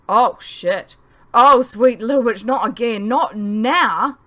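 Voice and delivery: loud, alarmed swearing as the fire catches; oh no, not now, near shouting; panicked outburst. Acted. Boy panicking as the leaf pile catches fire, loud alarm.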